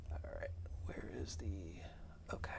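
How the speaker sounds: hushed whisper, barely voiced. Real, not acted. Putting the baby down for a nap, whispering.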